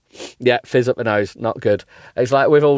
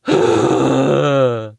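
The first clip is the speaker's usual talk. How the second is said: loud theatrical gasp; exaggerated gasp; 3 semitones higher, 4 dB louder than usual. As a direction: overdo the gasp. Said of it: "All together now".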